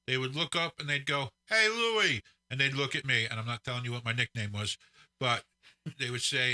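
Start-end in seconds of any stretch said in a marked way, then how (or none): 1.5-2.2 higher-pitched imitated street hail; calling out a greeting. Barbershop regulars greeting grandpa as he walks up, calling out. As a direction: hail an old pal from down the block.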